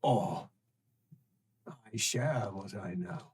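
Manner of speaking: low, hushed offer; low ominous voice. Acted. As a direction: lay out the choice in a hush. Creature offers choice.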